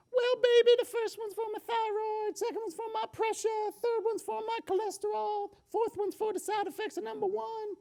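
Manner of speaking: put-on high, squeaky old Brooklyn grandma; Brooklyn accent. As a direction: play a sweet Brooklyn grandmother, high-pitched. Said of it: "she said in her Brooklyn accent".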